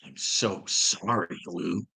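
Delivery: a put-on sorry, soft and slow; mock sympathy. Real, not acted. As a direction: pretend to pity him, slowly. Mock condolence.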